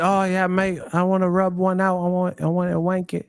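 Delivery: hammed-up British bloke; mock British accent. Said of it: "someone from the U K".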